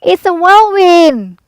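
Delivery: loud, high alarmed cry, slowed; cried out. A narrator's reading. Aunt Em cries out to the family as wind howls. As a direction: shout the warning high and loud. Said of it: "cried Aunt Em".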